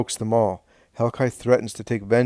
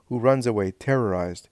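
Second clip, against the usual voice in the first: usual speaking voice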